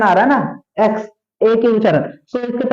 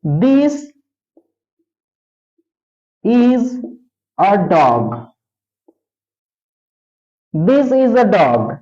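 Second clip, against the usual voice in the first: slow dictation; 2 semitones lower, much slower than usual. Shown as a demonstration. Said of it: "teacher dictates example sentence".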